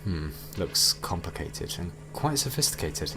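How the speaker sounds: usual speaking voice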